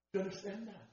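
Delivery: ordinary talk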